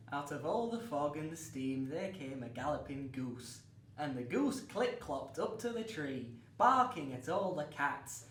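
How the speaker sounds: ordinary talk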